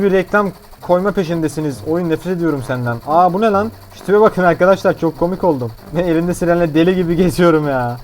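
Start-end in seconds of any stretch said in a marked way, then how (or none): none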